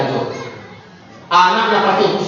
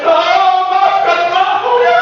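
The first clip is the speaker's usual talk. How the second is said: high, louder, slow pleading whimper; mock tearful parent; 12 semitones higher, 4 dB louder, much slower than usual. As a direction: ham up a parent melting into apology. Parent caving in, mocked.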